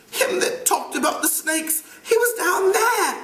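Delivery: put-on high, panicky boy's voice; Piggy gasping. Piggy searching for the missing boy, rising in pitch. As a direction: push it out anxiously.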